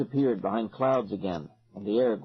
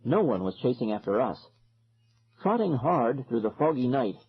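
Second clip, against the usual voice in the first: usual speaking voice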